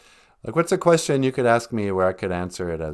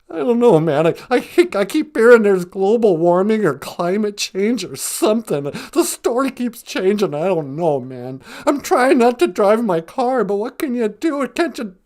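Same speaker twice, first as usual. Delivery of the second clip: higher-pitched, agitated doom-fearing lion; freaking-out Cowardly Lion; 11 semitones higher, 6 dB louder than usual. Acted. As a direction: ramble in rising panic to a loud peak. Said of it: "I'm just freaking out".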